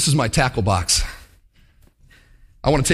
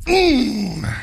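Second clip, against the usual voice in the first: devilish hum; 9 semitones higher than usual. Acted. Devilish fisherman setting hook.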